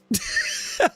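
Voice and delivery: high squeaky burst of laughter; high-pitched laugh. Real, not acted. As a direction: break into a high giggle. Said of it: "I can't even say that with a straight face".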